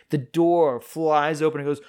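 normal talking voice

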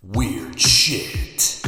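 a punchy announcer-style retake of "Weird shit"; catchphrase retake. Shown as a demonstration. Redoing the segment tag for the camera, as a punchy catchphrase. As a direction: land "Weird shit" like a show's signature line. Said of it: "we'll do it one more time".

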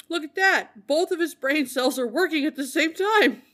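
sarcastic excited taunt; mocking exclamation. Mocking drunk, quoting crew.

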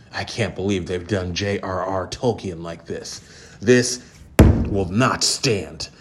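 put-on voice of an outraged fanboy, hammed-up; mocking basement dweller. Mocking an angry Tolkien fan who lives in grandma's basement, put-on voice. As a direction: sneer it out like a self-important nerd ranting. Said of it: "some dude who lives in his grandma's basement".

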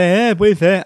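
loud, nasal mumbled agreement; muffled fanho voice. Retold or imitated. Asked about his odd voice, muffled nasal agreement. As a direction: imitate answering through a blocked nose and mask. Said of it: "e essa voz aí".

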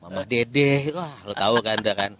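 ordinary talk

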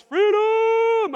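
high, loud battle cry; Braveheart freedom yell. Retold or imitated. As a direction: roar it like Mel Gibson on the rack. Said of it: "he yells".

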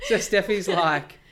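can't hold back the laugh. Real, not acted. Laughing while retelling the cab lie.

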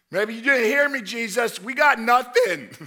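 loud, high, mockingly patient; sarcastic fisherman shouting. Disciples shout back at Jesus, sarcastically insisting they caught nothing. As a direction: shout back as if he's deaf. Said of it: "And Jesus goes".

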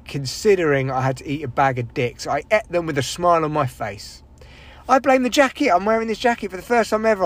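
ordinary talk, nothing marked

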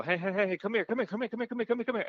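high, rapid-fire mock urgency; acted-out official calling someone over urgently; urgent summons. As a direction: rattle it off in a rising, frantic stream. Airport staff urgently calling him over.

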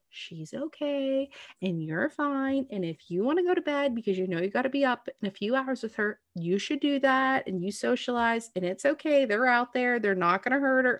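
calm her down gently; reassuring. Retold or imitated. Reassuring an anxious new mom.

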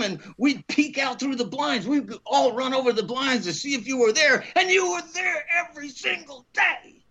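high-pitched, building acted enthusiasm; impassioned praise. Boss praising the student's week of waiting, impassioned. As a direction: imitate someone thrilled by his persistence.

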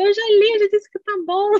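loud, high mock exasperation; imitated irritated mother. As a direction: act out a fed-up mother insisting. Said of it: "minha mãe começa a ficar irritada".

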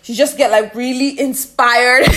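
ordinary talk

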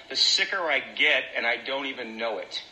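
ordinary talk, nothing marked

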